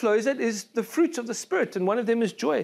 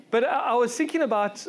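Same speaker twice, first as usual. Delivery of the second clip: ordinary talk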